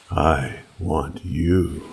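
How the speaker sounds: plain reading voice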